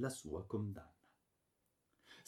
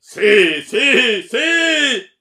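three roars climbing ever higher; furious yell; 23 dB louder, much slower than usual. A narrator's reading. Baron confirming he will kill her, screaming. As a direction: scream each word louder than talk, rising in rage. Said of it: "urlò il barone".

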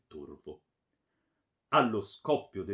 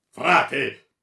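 very loud, raised warning cry; powerful, terrible shout; 4 semitones higher, 15 dB louder than usual. A narrator's reading. Baron cutting off the friar, terrible shout. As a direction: roar it with terrible force. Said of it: "gridò con voce potente e terribile".

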